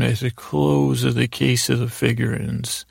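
usual speaking voice

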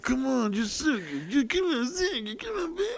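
high, soft whiny pleading; mock cranky begging. Cranky guy begging his partner, whiny pleading. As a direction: wheedle in a tiny high whimper. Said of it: "getting, like, kinda cranky".

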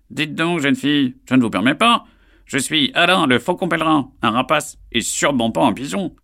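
high, loud bird of prey; proud raptor speaking; stern falcon voice. Acted. Offended falcon Alain.

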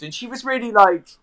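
usual speaking voice

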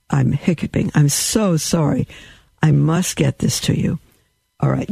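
ordinary delivery

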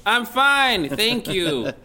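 loud, high, overblown radio guest; mock radio voice. Guest answering host's greeting, parodying his radio voice. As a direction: boom it out like a hammy broadcaster. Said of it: "Do we have to talk like this".

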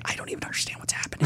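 breathless, fast whisper of confusion; clueless whisper. Retold or imitated. Lost newcomer on her first notes call, whispering.

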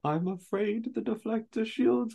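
slow, high mock-villain voice; Palpatine. Emperor taunting about the shields, slow sarcastic high voice. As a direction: stretch it out with gloating sarcasm, pitched high. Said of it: "not butcher this Palpatine impression".

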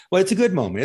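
high and bright through a laugh. Real, not acted. Host laughing with his tearful guest. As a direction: laugh the words out warmly.

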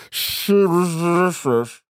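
slow, slushy "Shame is delicious" through laughter; exaggerated lisp. Shown as a demonstration. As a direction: drag out the sh sounds in "Shame is delicious". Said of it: "so many stern sh sounds".

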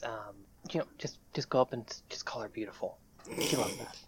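hushed aside. Acted. Host confiding aside about daughter.

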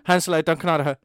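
said fast and loud. Shown as a demonstration. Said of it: "if you say it fast enough".

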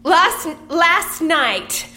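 high, very slow stage declamation; overwrought monologue. Acted. Actress performing monologue for director, overwrought delivery. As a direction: stretch it out high and melodramatic. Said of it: "Break 'em down and then build 'em back up".

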